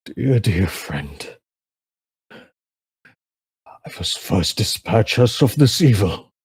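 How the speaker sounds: put-on slow, solemn old warrior pleading with his friend; heartfelt old man, slow and grave; old man's plea. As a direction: speak it like a tired old hero vowing to save a friend. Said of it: "you just hear him go".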